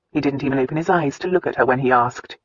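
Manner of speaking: ordinary delivery, nothing marked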